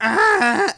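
loud high dinosaur roar; T-Rex roar. Pretend. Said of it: "make the noise".

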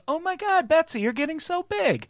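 high, gushing creepy man voice; creepy family friend. Retold or imitated.

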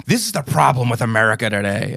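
lightly voiced pompous outrage, imitating; a hint of a self-righteous pundit, mocking; mock outraged poster. Mocking people who repost tabloids, in mild pompous outrage. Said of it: "they're like".

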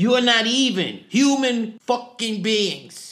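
loud, high, slow drill sergeant tirade; slow, shouted military insult; Sergeant Hartman. Acted. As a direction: chew out the recruits, hitting every word. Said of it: "Sergeant Hartman".

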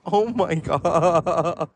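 drawn-out, horrified mock exclamation; mortified reaction. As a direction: stretch the last word out in dismay. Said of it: "her reaction was".

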